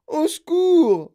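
building, high-pitched distress calls; bawling for help. A narrator's reading. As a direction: bawl out for rescue, stretching the last one. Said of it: "brailla-t-il".

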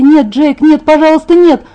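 high, loud terrified pleading; horrified gabble. A narrator's reading. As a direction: beg frantically at the top of the voice. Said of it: "тараторила я в ужасе". (в ужасе, громко умоляя)

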